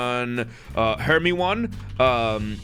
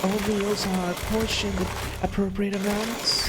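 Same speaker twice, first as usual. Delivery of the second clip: lightly higher voiced game dialogue; character dialogue voice; 9 semitones higher, much faster than usual. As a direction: read the lines as the character. Voicing characters reading dialogue.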